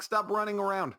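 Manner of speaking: put-on scolding the running kids; yelling at kids. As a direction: snap at the kids to stop. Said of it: "I'm like".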